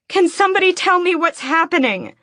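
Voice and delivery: very high, loud frantic cry; desperate scream. Acted. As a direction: scream the question at everyone. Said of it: "I scream".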